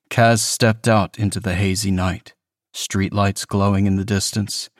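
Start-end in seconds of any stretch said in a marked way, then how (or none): none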